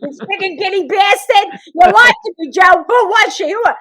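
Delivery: high, loud accusing yell; imitated mother screaming at dad; enraged mother. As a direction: shriek like a betrayed wife. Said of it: "And she goes".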